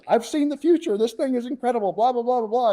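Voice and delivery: over-the-top excited rave in a high put-on voice; gushing report. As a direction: ham up the breathless rave. Mocking his own gushing test report.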